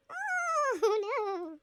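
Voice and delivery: high, drawn-out wail of dismay; mock dismay. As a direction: stretch out a playful oh no. Darkness closing in on player.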